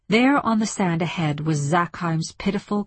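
ordinary delivery

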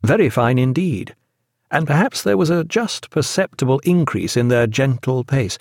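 usual speaking voice

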